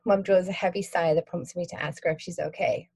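plain reading voice

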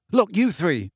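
mildly angry. A narrator's reading. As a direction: call them to order crossly. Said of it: "said Harry angrily".

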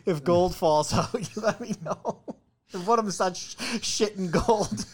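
laughing through the joke. Real, not acted. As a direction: giggle through the words.

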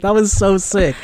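ordinary talk, nothing marked

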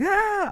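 very high mock cry of pain; hammed-up squeal, obviously fake; exaggerated pained wail. [name] answering whether she is okay, fake wailing. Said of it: "this seems fake as fuck".

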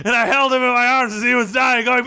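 usual speaking voice

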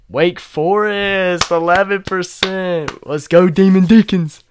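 high, loud, slow sports-fan hype; hype cheer. Real, not acted. Hyping up the Wake Forest listeners, high and loud.

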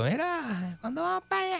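high, soft, drawn-out teasing quote; imitating her. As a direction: mimic her in a high little voice. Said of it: "que te dijo".

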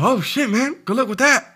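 put-on high, loud, indignant drunk buddy; buddy yelling as he leaves, high and loud; rowdy drunk friend. Drunk friend mocks school.

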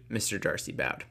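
ordinary delivery

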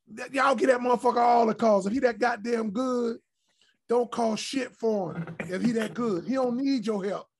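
loud, high heated yell at the referee; yelling at the ref. Retold or imitated. Player yelling at the referee about the calls, loud and heated. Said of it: "I walk over there and say".

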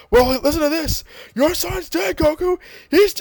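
high, taunting sneer; gloating villain. Retold or imitated. Cell taunting Goku about his son, high mocking gloat.

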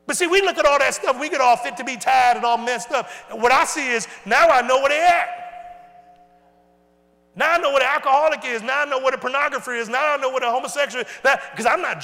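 shouting preacher climbing, quickening; full-force preaching. Real, not acted. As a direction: hammer it home from the pulpit.

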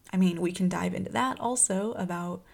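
ordinary talk, nothing marked

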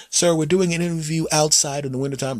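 politely baffled interviewer; reporter voice. Acted.

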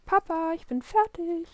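put-on loud, high child's call. A narrator's reading. Willi ready for kindergarten calls Papa, calling out. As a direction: announce it proudly across the flat. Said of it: "ruft Willi".

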